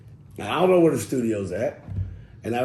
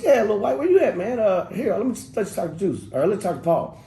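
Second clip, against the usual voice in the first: higher, louder, breezy phone greeting; friendly studio guy; 8 semitones higher, 3 dB louder than usual. Retold or imitated. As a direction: play the easygoing guy picking up. Voicing Pancho on the phone.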